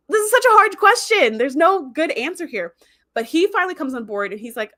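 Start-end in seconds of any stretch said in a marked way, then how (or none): 0.1-2.7 high, loud exasperated outburst; loud, high-pitched dismay. Real, not acted. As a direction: agonize over it, starting high and easing down. Exclaims over an impossible dilemma.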